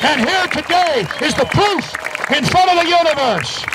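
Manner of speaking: high, impassioned oration; rousing speech. Real, not acted. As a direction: preach it like a solemn pledge. Solemn rally pledge oration.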